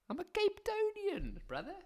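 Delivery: high Cape Town boast. Acted. Answering mock challenge, slow high.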